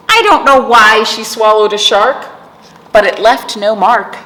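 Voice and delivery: sing-song rhythmic read-aloud; reading to children. Acted. Teacher reading a picture book to kids, sing-song. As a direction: lilt the rhyme for little listeners.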